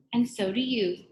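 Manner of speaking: a hint of a charming Scottish gent; Scottish gentleman. A narrator's reading. Teacher returns the visitor's compliment, light Scottish gentleman. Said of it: "speaking like the gentleman from Scotland".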